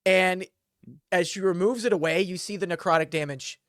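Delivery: normal talking voice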